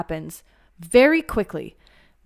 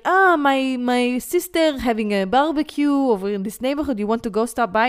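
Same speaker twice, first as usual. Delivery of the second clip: louder, higher casual Israeli guy; 5 semitones higher, 5 dB louder than usual. Retold or imitated. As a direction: toss off the invite like an easygoing Israeli date. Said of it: "be like".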